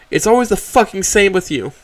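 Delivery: high, loud bitter snarl; angry growl. Acted. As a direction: spit it out in contempt. Trish raging at Mo.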